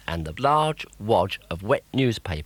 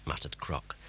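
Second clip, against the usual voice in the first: usual speaking voice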